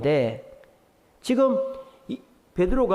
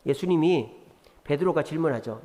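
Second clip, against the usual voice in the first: usual speaking voice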